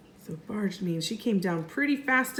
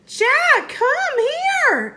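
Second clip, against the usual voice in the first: high, loud call; 6 dB louder, much slower than usual. Acted. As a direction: shout across the hill. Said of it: "Annie called".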